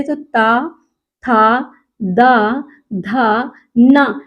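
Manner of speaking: slow, deliberate dental ता था दा धा ना; teacher demonstrating dental consonants; dental syllables. Shown as a demonstration. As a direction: sound each syllable out slowly with the tongue on the teeth. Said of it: "दांत से बोले जाने वाले".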